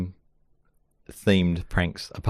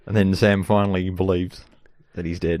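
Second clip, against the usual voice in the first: ordinary delivery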